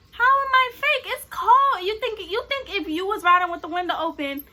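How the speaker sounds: high, loud argumentative comeback; indignant. Retold or imitated. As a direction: fire back in protest. Acting her side of quarrel.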